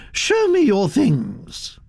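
put-on high, gossipy old aristocrat voice, pitched well up; elderly Duchess. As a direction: chatter on like a grand old lady. Duchess voiced, slow command.

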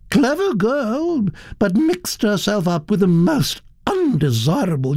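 high, gossiping Duchess with sweeping pitch; haughty high-pitched society gossip; imperious old Duchess. Acted. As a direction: rattle off family scandal as a grand, opinionated Duchess.

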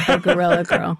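real laugh breaking into the phrase; amused, laughing as the words come out. Real, not acted. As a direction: say it through a laugh. Amused by own anecdote.

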